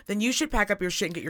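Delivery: normal talking voice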